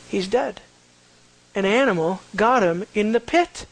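ordinary talk, nothing marked

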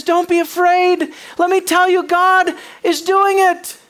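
very high and loud, urging the army on; stirring commander's shout, slowing on the last words; rallying cry. Acted. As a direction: call out to the troops with full conviction. Joshua rallying the army leaders.

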